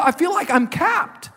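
hammed-up upset son; mock indignant teen. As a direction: play the aggrieved teenager, overdone. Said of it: "He said".

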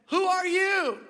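high, loud holler; storyteller's shouted challenge; yelled question. Retold or imitated. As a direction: call out to an unseen stranger. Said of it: "Every time I yell out".

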